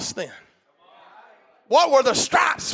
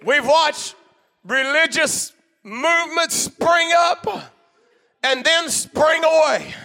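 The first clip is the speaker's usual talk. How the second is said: preaching at full force; 4 semitones higher than usual. Real, not acted. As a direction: preach it out over the congregation.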